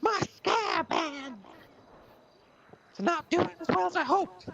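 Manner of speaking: very high, loud, cracking plea; high loud wail of a needy patient; desperate patient voice. Acted. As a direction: squeal it like a frantic little man. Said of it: "What was that?".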